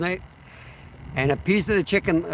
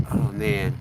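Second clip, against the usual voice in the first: gagging; 8 semitones lower than usual. Real, not acted. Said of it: "Sorry that I'm gagging on the smell".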